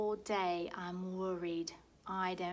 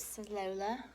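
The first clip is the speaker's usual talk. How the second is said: usual speaking voice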